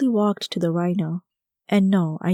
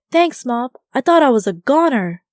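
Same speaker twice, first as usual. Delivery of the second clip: higher, louder relieved thanks; breathless excited thanks; relieved exclaim; 5 semitones higher, 6 dB louder than usual. Acted. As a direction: burst out with relief after escaping. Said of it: "I exclaimed".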